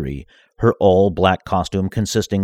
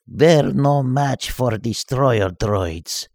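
slow, higher gloating voice; 5 semitones higher, much slower than usual. Acted. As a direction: gloat to the dark figure. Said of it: "Vasily said to him".

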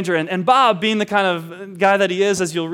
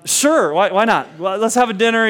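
louder, bright go-along; cheerful yes; 6 semitones higher, 7 dB louder than usual. Retold or imitated. Homeowner agrees to host the dinner, louder and eager. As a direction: shrug and say yes with gusto.

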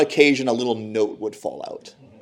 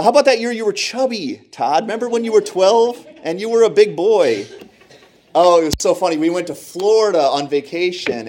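higher, gleeful mom telling embarrassing tales; teasing mom, amused; 4 semitones higher, 3 dB louder than usual. Retold or imitated. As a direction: gush like a mom embarrassing her son. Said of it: "She's like".

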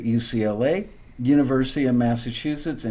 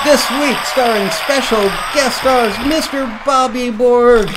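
put-on booming kids show opener; 11 semitones higher, 7 dB louder than usual. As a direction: announce the guests like a game show. Announcing guests with music.

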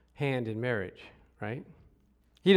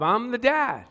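ordinary talk, nothing marked

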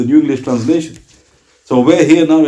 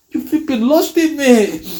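mock exasperation; 11 semitones higher, slower than usual.

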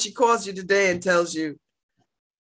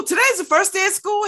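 excited; 12 semitones higher than usual. Real, not acted. Excited back-to-school announcement.

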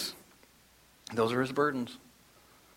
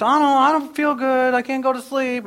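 put-on much higher, plaintive child voice; whining sick daughter; 11 semitones higher, 4 dB louder than usual. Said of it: "one daughter that's sick right now".

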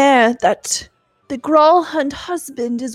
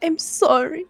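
put-on weepy drunk; 5 semitones higher than usual. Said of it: "the weepy drunk time now".

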